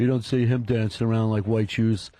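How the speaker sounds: low-pitched mock dad; imitating a dad's low voice; approving dad voice. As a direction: grumble it like somebody's dad, down low. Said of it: "the player that your dad would say".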